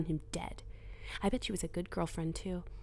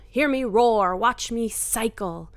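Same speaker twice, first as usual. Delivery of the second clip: louder, proud and triumphant; 4 semitones higher, 8 dB louder, slower than usual. Acted. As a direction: declare it like a victory cry. Triumphant declaration.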